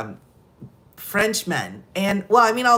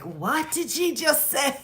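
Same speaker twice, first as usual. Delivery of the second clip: Italian relative; 6 semitones higher, 4 dB quieter than usual. Retold or imitated. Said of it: "hear my Italian relatives like".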